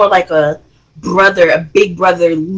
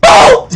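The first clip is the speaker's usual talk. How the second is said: mock boom; 7 dB louder than usual. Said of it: "Jock was just like".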